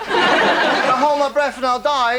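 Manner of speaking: laughing slow mock of a sulky threat; mock girlfriend threat. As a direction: drag it out with a laugh, mocking her.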